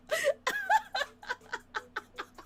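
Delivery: a long shrieking laugh up high; real helpless cackle, way up high; high squealing laugh. Real, not acted. Bursts out laughing at scene.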